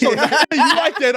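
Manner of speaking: high laughing words. Real, not acted. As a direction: laugh as you say it.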